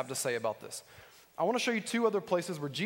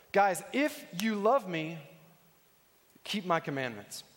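slow, deliberate verse reading with long pauses; slower than usual. Shown as a demonstration. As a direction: draw out each phrase. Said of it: "where you put the emphasis when you read this".

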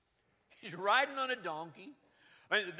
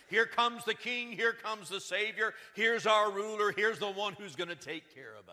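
royal announcement; 2 semitones higher, 7 dB louder than usual. Retold or imitated. Herald announcing a warrior king's arrival, proclaiming loudly. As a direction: call it out like a herald before a king. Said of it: "trumpets sound and announce".

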